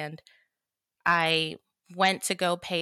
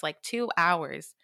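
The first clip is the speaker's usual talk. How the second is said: ordinary delivery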